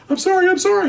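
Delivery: very high imitated woman's plea; mock pleading apology. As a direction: squeal a sudden, repeated surrender. Mother suddenly gives up and apologizes.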